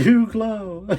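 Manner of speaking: high, loud mock of an awkward compliment; mocking imitation. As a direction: parrot the line in a silly high voice. Host mocks the awkward clip line.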